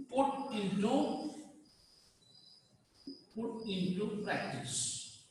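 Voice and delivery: very soft, drawn-out "put into practice"; slow dictation. Shown as a demonstration. Teacher dictating to students as they write, slow soft dictation. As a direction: murmur "put into practice" slowly, pausing after "into".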